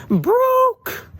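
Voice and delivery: high squeaky outburst of shock; dismayed cry of broke; shocked exclaim. A narrator's reading. Narrator exclaims family is broke.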